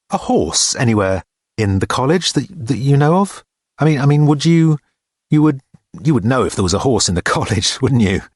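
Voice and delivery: halting, fumbling question with false starts; hesitant Richard. A narrator's reading. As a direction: fumble awkwardly towards the question.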